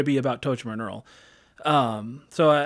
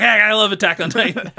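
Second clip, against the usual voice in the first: higher, louder quote of a joking frontman; joking enthusiasm; 7 semitones higher, 9 dB louder than usual. Retold or imitated. Quoting the singer reassuring the crowd after his joke, loud joking enthusiasm. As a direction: blurt it out as playful, imitated fan excitement. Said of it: "But he was just joking".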